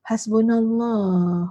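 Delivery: very slow, drawn-out listless delivery; wrong, listless intonation. Shown as a demonstration. Ustazah showing how not to say it, listless. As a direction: drag it out as if merely resigned. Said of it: "Bukan intonasi".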